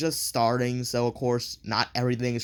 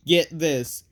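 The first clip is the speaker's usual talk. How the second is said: ordinary talk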